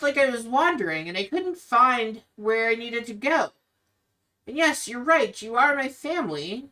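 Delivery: Irina, hurt. Acted. Irina tells her brother Ismark she never belonged. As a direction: push back gently, wounded.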